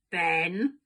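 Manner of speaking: low, loud warning growl of the name. A narrator's reading. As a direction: warn him off, one sharp low word. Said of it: "growled Dad".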